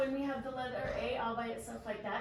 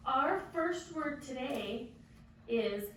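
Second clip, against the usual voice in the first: slow, drawn-out new word; slow teacher modeling; much slower than usual. Shown as a demonstration. Teacher slowly introducing a new word to the class. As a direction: say it slowly so children can copy. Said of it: "Can you say that word?".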